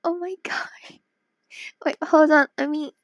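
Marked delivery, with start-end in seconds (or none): 0.0-1.0 softer, breathy overwhelmed whisper; emotional whisper. Real, not acted. Streamer overwhelmed by returning viewer, tearful whisper. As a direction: barely get it out, choked up.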